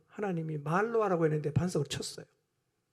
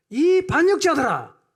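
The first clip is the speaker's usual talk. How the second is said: loud, high, drawn-out shout as Moses; acted furious roar; angry outburst; 8 semitones higher, 11 dB louder, slower than usual. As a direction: explode at the crowd, acting Moses. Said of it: "감정이 그냥 확 폭발했어요".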